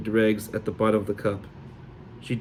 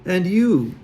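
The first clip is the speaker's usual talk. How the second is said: put-on Sully accent; 8 semitones higher, 4 dB louder than usual. Fake fortune.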